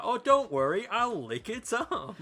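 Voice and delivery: high, loud silly cartoon voice. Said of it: "He's like".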